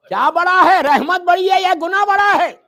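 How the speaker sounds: high, loud rhetorical question; loud challenge. Acted. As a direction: demand the answer from the crowd. Preacher challenges congregation.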